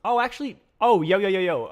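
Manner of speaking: sudden burst of loud excitement; yelling yo with a new idea; excited. Real, not acted.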